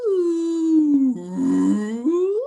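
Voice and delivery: high, breathy sexy ooh; sexy whale call. Pretend. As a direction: moan it out like a whale. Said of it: "usually it's a little more sexy".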